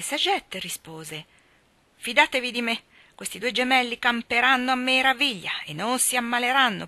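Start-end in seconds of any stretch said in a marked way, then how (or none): 2.0-6.9 higher, louder, assured midwife; confident reassurance. A narrator's reading. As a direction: reassure the parents with emphatic conviction.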